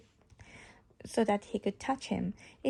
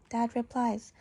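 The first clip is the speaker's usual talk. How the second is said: usual speaking voice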